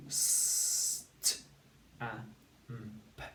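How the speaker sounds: "St-, a, m, p" as separate sounds, long s into a crisp t; sounded out. Shown as a demonstration. Teacher inserts the missing sound for pupils, sounded out. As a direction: segment "St-, a, m, p" with gaps between sounds. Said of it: "They need a t sound".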